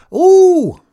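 very high, very loud bellow, proudly replayed; retold yell. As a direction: show the men how she scared the bear off. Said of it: "I grinned right back and yelled".

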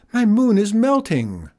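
high, loud dismay; crying out. A narrator's reading. Farmer laments the melting moon, crying out. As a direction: wail it high. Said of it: "cried Abel".

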